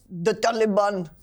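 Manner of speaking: "the Taliban" drawn out slowly with Arabic-style sounds; slow, deliberate Arabic pronunciation of "the Taliban"; mock Arabic accent. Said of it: "say any word ... with an Arabic accent".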